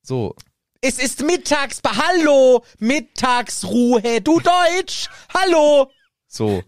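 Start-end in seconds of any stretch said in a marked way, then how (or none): 0.8-5.9 high, loud, slow, over-simple German; German talking down to foreigners. Retold or imitated. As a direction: speak slowly to a foreigner as if they were dim. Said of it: "So wie Deutsche mit Ausländern".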